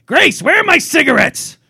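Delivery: high, loud, slow grandpa holler; hammed-up old man yelling through the house; angry old Italian man. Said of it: "that was his line".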